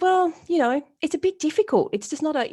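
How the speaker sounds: a hint of an awkward CEO dodging; hesitant excuse. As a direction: squirm a little as the boss explains it away. Retold CEO excusing himself.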